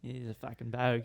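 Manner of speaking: put-on softly tried-out Australian bogan accent; quiet, throwaway bogan accent impression; imitated bogan accent. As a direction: mimic an Australian bogan under your breath. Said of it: "how a bogan talks".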